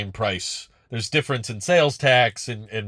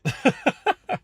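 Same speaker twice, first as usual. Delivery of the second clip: high-pitched burst of laughter. Real, not acted. Laughs at viewer's story.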